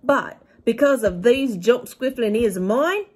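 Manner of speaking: big, high, loud giant voice, kindly and earnest; a friendly giant explaining wonders, up high and loud. A narrator's reading. BFG voice reading story.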